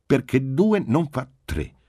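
brusque mother, punching "due"; brusque, no ill humour. A narrator's reading. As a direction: cut off the questioning without real temper. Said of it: "bruscamente ma senza malumore".

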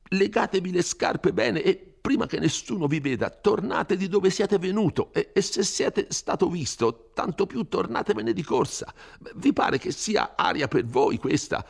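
put-on high, anxious old curate scolding; an old man begging him to flee, high and fearful; fretful old priest. Frightened curate urging Renzo to leave the village, pleading high and anxious.

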